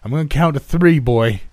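high, loud yell from dad; yelled threat. A narrator's reading. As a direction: shout a warning to his boy. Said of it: "my dad yelled".

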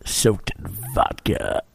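low, slow, muffled mumble; low mumbled voice. Real, not acted. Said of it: "You're talking like a dickhead, so it's hard to hear you".

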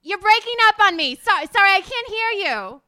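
loud, high, mock-apologetic phone voice; dramatic lyric reading. Guest brushing off caller in lyric reading, loud and hammed-up. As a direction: play breaking up on the phone, loudly. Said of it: "have you read some lyrics of hers".

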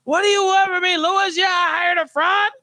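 hammed-up defensive outburst; mock-emotional Harvey shouting back. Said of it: "Harvey getting so emotional and being like".

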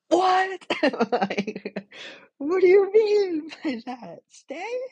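high, loud, incredulous squeal of what; stunned disbelief. Retold or imitated. Answering God's call to stay in Mexico, a high shocked squeal.

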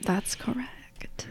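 breathy whispered confirmation. Real, not acted. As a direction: drop to a whisper. Whispered aside after intro spoof.

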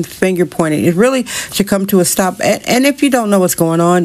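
ordinary delivery

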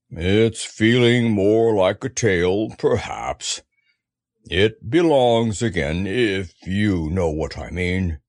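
slow, low, aggrieved drone; gloomy donkey. A narrator's reading. Eeyore thanks the boy who dries his tail, gloomy. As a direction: plod through it, low and mournful.

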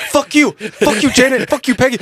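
high, loud angry yelling; furious shouting. Pretend. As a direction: scream it at the neighbours. Mock outraged neighbour yelling.

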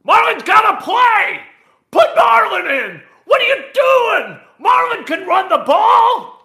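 hammed-up yell like an idiot parent at a high school game; mock parent shouting. Host mimicking parents yelling at the coach, shrill mock shout. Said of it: "Kind of like".

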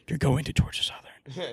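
hushed whisper into an ear; soft whispered urging. Retold or imitated. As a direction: breathe it like a secret. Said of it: "his dad whispers in his ear".

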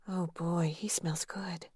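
breathless whisper, very soft; a secret swoon under the breath; whispered thought. Acted. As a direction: murmur it to herself, dazzled. Dana's thought slips out.

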